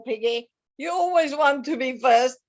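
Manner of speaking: normal talking voice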